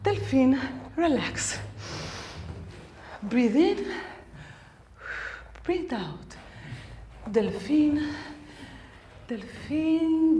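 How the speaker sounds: soft slow calming voice; soothing. Acted. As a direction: breathe and soothe yourself gently. Calming herself.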